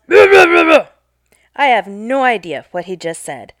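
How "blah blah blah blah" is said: loud, higher mumbled yelling; retold yell. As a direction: yell the gibberish at her. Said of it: "He yells".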